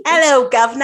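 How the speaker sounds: hammed-up Cockney call; Cockney accent. Shown as a demonstration. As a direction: put on a jaunty Cockney accent. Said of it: "Co-, Cockney".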